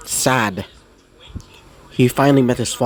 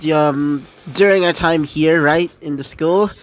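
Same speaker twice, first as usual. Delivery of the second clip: higher, breezy, teasing buddy voice; cheeky demon pal; 4 semitones higher than usual. Acted. Pyro Jack coaxing Izuku to the roll place, higher and breezy. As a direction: talk him into going out for fun.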